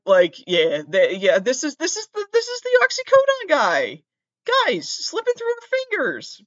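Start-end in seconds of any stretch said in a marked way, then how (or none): 1.4-6.4 very high, squealed police parody; mock excited cop. Police spotting the oxycodone guy, high mock alarm. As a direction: squeal it like an overexcited officer.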